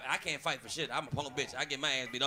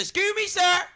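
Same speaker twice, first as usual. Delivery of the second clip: higher, louder, prissy polite tone; mock polite voice; 10 semitones higher, 5 dB louder than usual. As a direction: put on an overly polite voice. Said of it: "I said".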